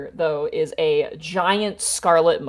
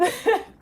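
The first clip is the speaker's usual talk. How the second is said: parrot cackle. Acted. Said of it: "the parrot just starts cackling".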